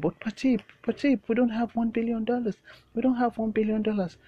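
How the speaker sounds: very high, loud desperate plea; pleading secretary. Acted. Secretary pleading with Patip over the ransom, high frantic. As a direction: beg Patip, voice way up. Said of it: "he'll be like".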